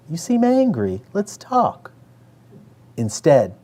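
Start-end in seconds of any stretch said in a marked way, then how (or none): 0.1-1.9 high, lilting sing-song; mock a therapist's sing-song calm. Repeating the therapist's rehearsed phrase, sing-song voice. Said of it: "said in a sing-song voice".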